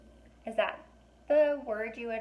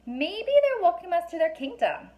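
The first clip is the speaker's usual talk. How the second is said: put-on much higher, delighted little-girl voice; a kid who thinks the herd greets her; dreamy girl voice; 9 semitones higher, 4 dB louder than usual. A narrator's reading. Girl to her twin among bowing unicorns, much higher and delighted. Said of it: "Alex said".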